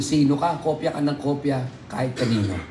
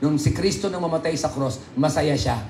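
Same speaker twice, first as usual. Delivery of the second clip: normal talking voice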